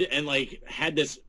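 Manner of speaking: normal talking voice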